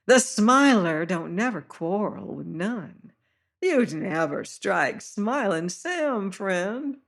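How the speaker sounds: oily friendliness, drawn out; smiling high-voiced fat seaman; high-pitched voice. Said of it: "high-pitched voice".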